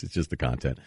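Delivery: normal talking voice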